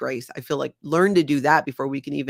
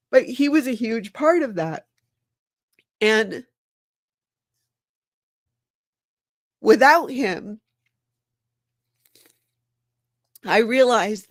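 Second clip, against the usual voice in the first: crying; 4 semitones higher than usual. Real, not acted. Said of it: "Let's cry it out".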